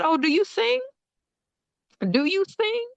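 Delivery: excited surprise. Retold or imitated. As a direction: tease him with thrilled surprise. Teasing excitement about singing.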